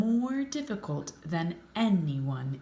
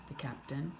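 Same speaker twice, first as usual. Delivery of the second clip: ordinary delivery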